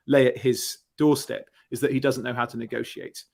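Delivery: usual speaking voice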